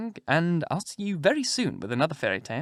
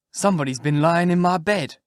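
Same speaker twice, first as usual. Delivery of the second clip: lightly voiced bear, louder than the narration; middle-sized bear; 4 semitones higher, 5 dB louder than usual. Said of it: "in his middle-sized voice".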